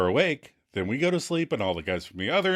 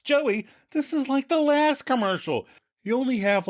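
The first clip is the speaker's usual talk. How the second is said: high-pitched, strained mock scolding; exasperated lambasting; 13 semitones higher than usual. Ross lambasts Joey over the last commercial, exasperated. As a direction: lambast him in a high, incredulous voice. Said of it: "Ross lambasts".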